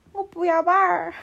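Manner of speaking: mimicking a cross little boy, high and loud; 气狠狠. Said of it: "他气狠狠地说". (学小孩气狠狠地拒绝)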